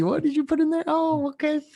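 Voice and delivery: high, mocking interrogator; mock judgmental voice. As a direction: voice a judgy friend checking drinks. Mock quiz of coffee drinker.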